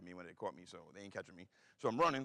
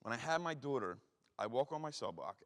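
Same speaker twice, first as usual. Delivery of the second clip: usual speaking voice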